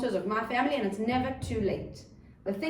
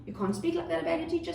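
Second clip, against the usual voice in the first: higher-pitched mock telling-off; parental reprimand; 7 semitones higher than usual. Parent correcting a child mid-outburst, mock reprimand. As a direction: scold the child on reflex. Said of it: "You don't say at that time".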